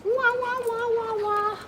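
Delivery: high, sing-song pet voice. Real, not acted. Baby noises to dog.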